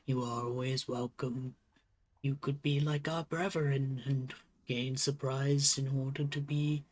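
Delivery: lightly voiced wise old turtle, unhurried; slow, measured elder character voice; old turtle leader.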